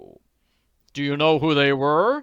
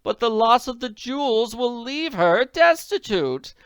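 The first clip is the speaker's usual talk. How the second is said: much higher and louder, tearful lady pleading; on the verge of sobbing; 13 semitones higher, 9 dB louder than usual. A narrator's reading. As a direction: lament like she is about to cry. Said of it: "began to sob into her handkerchief".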